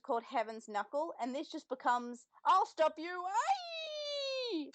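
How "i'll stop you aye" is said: shouted high battle cry with a soaring final aye; mock battle cry. Hosts mocking the dub attack name, hammed-up battle cry. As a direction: yell it like a cartoon attack move.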